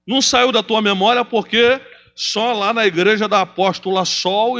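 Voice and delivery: loud, high, driving sermon climax; fiery preaching. Acted. Pastor preaching loudly to his congregation about forgiveness. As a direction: preach it hard to the crowd.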